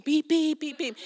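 car horn toot. Retold or imitated.

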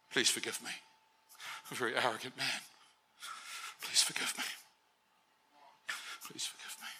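pleading softly, dying away to a whisper; fading to a whisper. Retold or imitated. Dying man begging God for forgiveness, fading to a whisper. Dying man's final desperate plea.

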